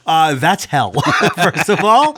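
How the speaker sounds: laughing through the words, slow and high. Real, not acted. Cohost laughing at his own hell joke, laughing. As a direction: crack up while you land the joke. Joking that Suffolk County is hell.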